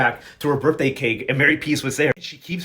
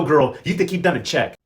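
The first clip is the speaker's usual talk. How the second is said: plain reading voice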